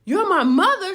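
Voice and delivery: very high, loud disbelief; floored by the reveal; shocked. Retold or imitated. Daughter learning her friend is her mother, shocked.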